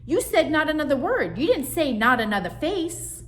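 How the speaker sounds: very high, loud screech; screeching sister. Acted. Sister screeching her innocence at mother. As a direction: protest at the top of her lungs. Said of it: "Zipper Nose screeched".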